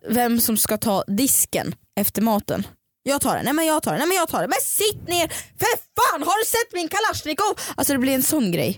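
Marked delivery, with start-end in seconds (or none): 3.0-7.5 mock insistent friend. Acted argument over doing dishes.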